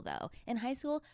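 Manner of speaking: ordinary talk, nothing marked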